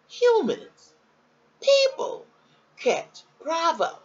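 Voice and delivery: high, loud, slow doctor's quote; quoting the doctor. Retold or imitated. Retelling the doctor's odd diagnosis, high and loud. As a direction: stretch it out high and loud as she quotes her. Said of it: "She said".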